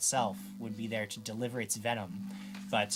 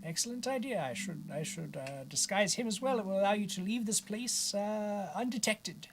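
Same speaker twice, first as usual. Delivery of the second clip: high, softer character voice; obliging Bartleby; 9 semitones higher, 4 dB quieter than usual. Acted. Wizard agrees to disguise prisoner, high soft character voice. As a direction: think aloud in a raised, put-on voice.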